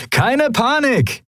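high, drawn-out jovial holler; cheery call. A narrator's reading. Sephiron greets his pirate pals, cheerful high call. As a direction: sing out slowly and high, in great spirits. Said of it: "rief Sephiron seinen Kumpanen in bester Laune zu".

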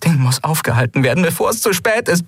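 high, desperate plea rising steadily; urgent pleading. Acted. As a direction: plead as if time is running out. Lien pleads urgently to stop the thing. (flehend, dringlich, immer höher)

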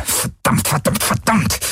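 starts voiceless, jumps high; frantic cursing. A narrator's reading. Pilot swears as ship breaks apart, frantic cursing. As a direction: spit out the repeated swear.

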